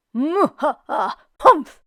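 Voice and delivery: mock vampire laugh into a humph; a hint of a villain's cackle; lightly voiced vampire humph; vampire's humph. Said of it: "said the vampire".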